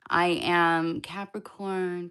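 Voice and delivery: ordinary delivery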